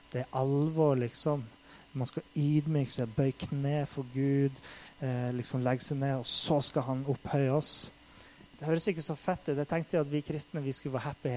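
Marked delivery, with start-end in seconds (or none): none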